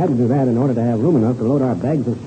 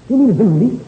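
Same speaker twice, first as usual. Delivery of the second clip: alarmed; 8 semitones higher, 5 dB louder than usual. Acted. Bad news about the pirate.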